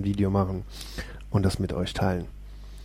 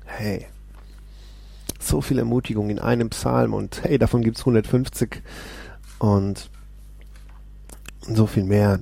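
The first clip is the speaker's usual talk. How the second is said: usual speaking voice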